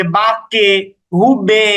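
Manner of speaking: "বাক্যে", "হুবে" with drawn-out e endings; exaggerated qalqalah. Shown as a demonstration. Teacher exaggerates to explain the direction, overdone bounce. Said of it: "এইভাবে অতিরিক্ত উচ্চারণটুকু করছি".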